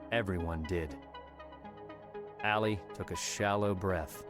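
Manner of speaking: ordinary delivery, nothing marked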